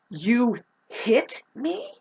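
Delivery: very slow, drawn out in mock shock; slow incredulous question to the man who hit her; stunned disbelief. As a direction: let each word hang in disbelief. Stunned reply after being punched.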